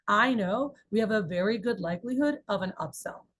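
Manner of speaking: ordinary delivery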